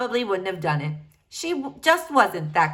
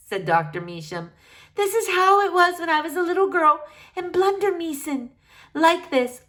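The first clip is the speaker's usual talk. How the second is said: remember home with longing; wistful old doctor; 6 semitones higher than usual. Acted. Doctor remembering her girlhood in Blundermeeson, wistful.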